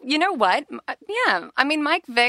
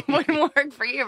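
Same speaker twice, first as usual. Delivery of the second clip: laughing teasingly, slow; 3 dB louder than usual. Real, not acted. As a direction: tease through a laugh.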